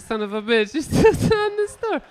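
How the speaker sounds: high, laughing disbelief; laughing exasperation. Retold or imitated. Dad finding son still mid-story, laughing in disbelief. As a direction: crack up at the kid.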